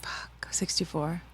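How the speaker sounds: hushed curse under the breath; frustrated whisper. Real, not acted. Guessing the card number, cursing a miss in a whisper. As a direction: whisper the swear, annoyed at missing.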